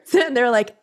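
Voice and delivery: usual speaking voice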